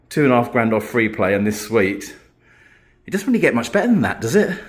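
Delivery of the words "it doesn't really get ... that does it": excited. Real, not acted. Thrilled about free suite.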